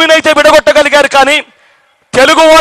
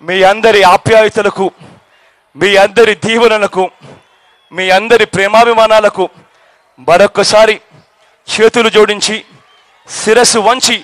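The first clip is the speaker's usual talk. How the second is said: lower, measured and sincere, in short phrases; heartfelt thanks; 6 semitones lower than usual. Real, not acted. Speaker thanking the crowd for their love and blessings, heartfelt and lower. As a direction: bring the voice down to thank them humbly, pausing often.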